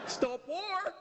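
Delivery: high, earnest plea of Stop war; celebrity activist. Pretend. LA celebrity pleading to the terrorists, high earnest voice. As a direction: plead like a preachy film star. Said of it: "I solve international crisis with my celebrity".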